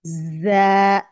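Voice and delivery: जा with a hissing, whistled z; whistling z. Shown as a demonstration. Teacher shows students the whistling z, hissed recitation. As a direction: make a whistle on the ज of जा. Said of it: "सफीर की आवाज आएगी".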